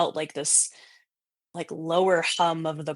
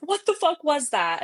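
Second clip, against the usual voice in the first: high-pitched retold alarm; scared outburst; 9 semitones higher, 4 dB louder than usual. Frightened ghost hunter reacting to the tape, retold scared outburst. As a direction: blurt it out, startled. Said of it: "I'm so scared".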